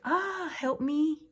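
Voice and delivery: high, slow pained cry for help; mock scream of pain. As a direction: imitate a hurt man calling for help. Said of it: "One of them was like".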